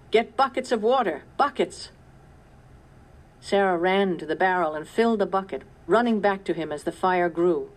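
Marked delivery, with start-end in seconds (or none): 0.1-1.9 higher, loud urgent call; shout for buckets. A narrator's reading. Papa shouting for buckets at the fire, urgent. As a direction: yell it over the flames. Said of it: "shouted Papa".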